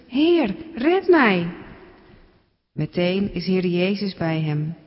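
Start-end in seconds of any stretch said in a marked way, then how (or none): 0.1-1.5 high, drawn-out plea for rescue; a sinking man begs to be saved; desperate cry. Acted. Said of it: "Hij schreeuwt".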